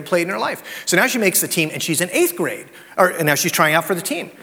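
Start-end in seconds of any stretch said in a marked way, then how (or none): none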